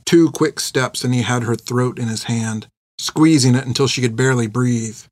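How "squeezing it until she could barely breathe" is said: ordinary delivery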